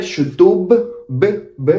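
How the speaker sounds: shutub with a clearly released b, then "b, b" alone; sounded final B. Shown as a demonstration. Teacher showing that some people do say the B, exaggerated final b. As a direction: pop the last b of shutub. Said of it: "we don't say B here".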